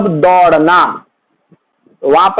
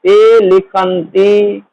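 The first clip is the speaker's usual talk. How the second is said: slow dictation; much slower than usual. Shown as a demonstration. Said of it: "का बहुवचन होता है लिखन्ति".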